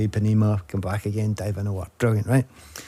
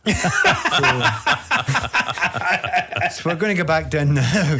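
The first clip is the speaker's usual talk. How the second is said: laughing fan; 6 semitones higher than usual. Retold or imitated. Scottish fan in Rio laughs about the embassy, giggling.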